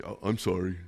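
half-hearted, low and soft mumbled sorry; insincere apology. Retold or imitated. Child made to apologize by mom, insincere. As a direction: imitate a grudging, low apology. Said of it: "you didn't really mean it".

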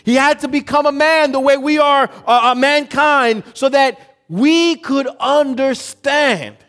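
high, loud shouted sermon; fiery preaching. Real, not acted. Preacher driving the point home to congregation, fiery shout. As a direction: preach it out at full force.

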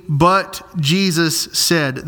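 very slow, weighty "But Jesus said"; slow emphasis. Shown as a demonstration. Preacher slowing down for his flock. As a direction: lean on each word of "But Jesus said" slowly. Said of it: "These are life-altering words".

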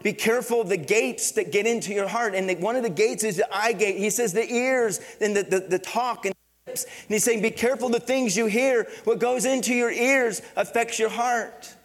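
loud, rising preacher's urging; impassioned pulpit delivery; preaching at full force. Acted. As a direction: drive the warning home to the church.

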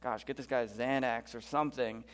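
mock under-the-breath grumble; muttering under breath. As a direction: mutter it to yourself, fed up. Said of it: "muttering under his breath".

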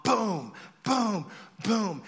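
loud, falling booms, spaced apart; imitated explosions. Storyteller imitating firecrackers at night, loud booms. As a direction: punch out each mock blast.

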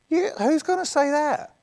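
incredulous. Real, not acted. Incredulous aside.

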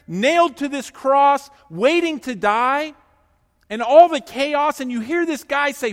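amazed preaching. Real, not acted. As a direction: marvel out loud at him.

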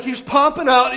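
normal talking voice

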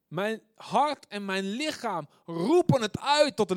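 high, loud, slow proclamation; fervent crying out. Acted. Preacher voicing heart and body crying to God, fervent. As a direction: cry it out, stretched and forceful.

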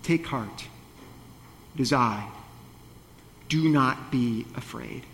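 Jesus calmly reassuring his scared disciples on the water; gentle reassurance. Acted. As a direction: soothe frightened friends quietly. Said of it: "he says".

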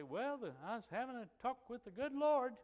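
put-on high-pitched head voice of an old man; imitating an aged uncle's high voice; Uncle Harold. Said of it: "his voice would go up higher".

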